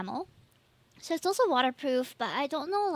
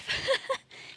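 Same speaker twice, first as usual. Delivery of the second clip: high giggle; 12 semitones higher than usual. Real, not acted. Laughs at her own slip.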